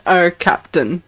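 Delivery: ordinary talk, nothing marked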